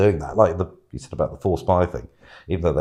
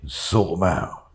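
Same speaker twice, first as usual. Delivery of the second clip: slow, low Brummie menace; lower, slower Birmingham accent; Arthur impression; 4 semitones lower, 5 dB quieter, slower than usual. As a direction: drag it out like a Birmingham gangster. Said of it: "Arthur impression from Peaky Blinders".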